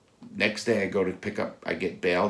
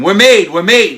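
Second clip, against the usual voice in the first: high, very loud panicked scream; 13 semitones higher, 19 dB louder than usual. Retold or imitated. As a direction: scream the warning at full force. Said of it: "So I scream".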